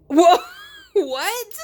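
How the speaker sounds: loud, high whoa breaking into laughter; sudden disbelief at what she sees; shocked laugh. Real, not acted. Discovering near-identical polishes.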